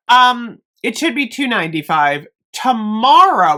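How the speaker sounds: ordinary talk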